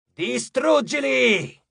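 high, drawn-out commanding cry; decisive command. A narrator's reading. Mars and Ares inside Frank's mind, resolute command. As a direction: order it with total resolve. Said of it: "gli parlarono all'unisono in tono deciso".

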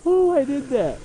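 high, bright burst of pride; pleased with yourself, pitch jumping up; proud surprise. Real, not acted.

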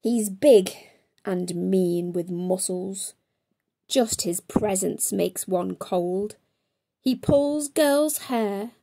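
ordinary delivery

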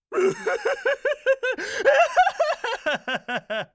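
high shrieking beast laugh; gleeful monster cackle. Acted. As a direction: cackle with delight at her fear. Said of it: "相手が怖がれば怖がるほど一層歓喜に燃えて".

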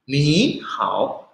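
"Ni hao" with "Ni" rising, "hao" dipping; quick-reading tones. Shown as a demonstration. Teacher showing the fast reading, rising then dipping tone. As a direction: say "Ni" as second tone, "hao" as third. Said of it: "waktu kita baca cepat".

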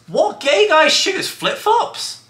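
very high, slow squeak of disbelief; mock disbelief. Boyfriend questioning a comment about his shoes, squeaky mock disbelief. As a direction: shoot way up into an incredulous falsetto.

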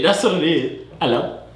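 normal talking voice

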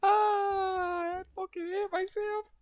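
high strained wail; frustrated wail. Real, not acted. Streamer wailing at himself after the keybind mistake, high frustrated wail.

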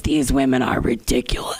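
whispering. Retold or imitated. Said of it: "you were like".

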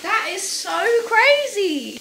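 loud, high and drawn out; real wide-eyed amazement; amazed excitement. Real, not acted. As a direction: marvel loudly, stretching the words slowly. Amazed at mattress expanding.

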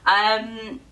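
ordinary delivery

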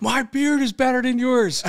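high, shouted heckle; screaming a taunt. Retold or imitated. Heckling a coach from the stands, mock screaming. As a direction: scream the jab at him. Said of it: "I started fucking screaming through that".

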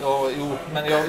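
usual speaking voice

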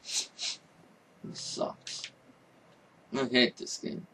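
whispered, deflated sigh of words; dejected whisper. Real, not acted. Gamer defeated after losing everything, whispered dejection. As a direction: barely breathe it out.

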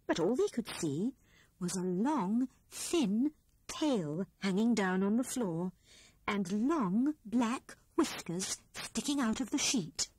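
ordinary delivery

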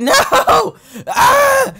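high shrieking cry as the rider dies; startled scream. Real, not acted. Yelping as the ragdoll gets crushed. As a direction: let out a sharp high yelp of shock.

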